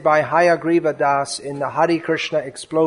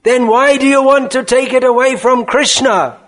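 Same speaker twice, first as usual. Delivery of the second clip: loud, raised-pitch outburst; furious roar; 7 semitones higher, 5 dB louder than usual. Acted. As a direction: roar it as an indignant challenge. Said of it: "he roars".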